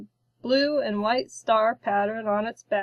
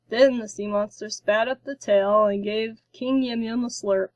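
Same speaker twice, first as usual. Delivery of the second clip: plain reading voice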